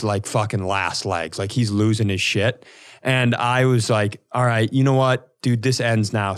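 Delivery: ordinary delivery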